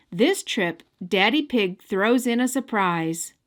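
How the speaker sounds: ordinary delivery, nothing marked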